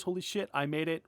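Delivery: ordinary delivery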